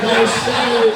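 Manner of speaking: retold sassy catchphrase, laughing; sassy no shade. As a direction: toss it off with attitude. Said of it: "And I said".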